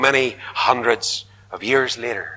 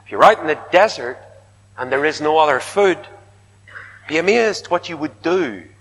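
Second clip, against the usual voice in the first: ordinary talk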